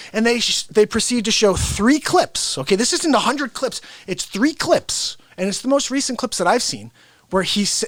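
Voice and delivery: ordinary talk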